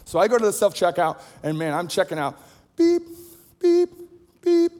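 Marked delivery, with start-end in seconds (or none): 2.8-4.7 high, short mouth beeps like a scanner; imitated scanner beeps. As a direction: beep out the checkout scanner.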